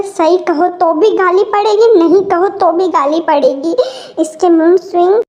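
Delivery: ordinary delivery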